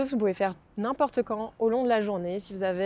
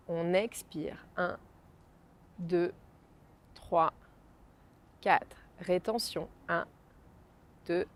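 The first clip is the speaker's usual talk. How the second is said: soft, slow, spaced counting; guided breathing count; 2 semitones lower, 4 dB quieter than usual. Acted. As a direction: count each breath calmly and slowly. Counts breaths slowly, softly. (décompte lent et doux de respiration)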